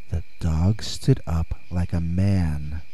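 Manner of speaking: plain reading voice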